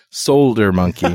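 Solder with a clearly sounded L; Richardish Solder. Retold or imitated. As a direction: say "sole-der" for Solder. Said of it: "to be Richardish".

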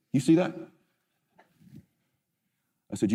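ordinary talk, nothing marked

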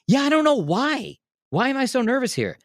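high, jittery mock-nervous voice; squeaky jittery disbelief; nervous squeak. Nervous on the tour bus.

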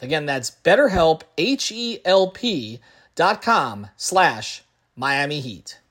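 upbeat sponsor read, slowing on the web address; podcast host reading a BetterHelp spot, spelling the address out slowly. Acted.

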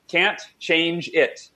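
Can't change it, each word slow and weighty; deliberate mantra; slow emphatic. Shown as a demonstration. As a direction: lay down "Can't change it" like a rule, one word at a time. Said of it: "You say three very powerful words".

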